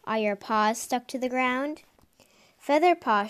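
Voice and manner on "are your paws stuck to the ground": usual speaking voice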